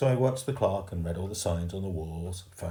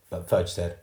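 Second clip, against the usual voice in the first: ordinary delivery, nothing marked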